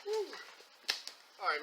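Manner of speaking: ordinary delivery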